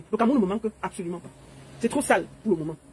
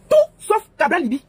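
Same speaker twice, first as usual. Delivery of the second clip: higher and louder, hammered home; emphatic slogan; 6 semitones higher, 5 dB louder than usual. Real, not acted. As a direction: bang the slogan out. Campaign slogan hammered repeatedly.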